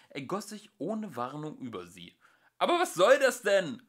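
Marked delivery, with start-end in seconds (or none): 2.6-3.8 high, loud exasperated cry; calling out. Acted. Arthur crying out under the custard, loud. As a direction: cry out at the absurdity. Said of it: "rief Arthur".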